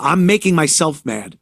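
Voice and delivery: normal talking voice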